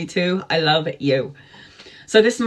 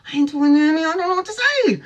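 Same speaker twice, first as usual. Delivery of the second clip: much higher, squeaky mock panic; 9 semitones higher, much faster than usual. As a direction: squeal it like a flustered girl. Retelling panicked reply to friend.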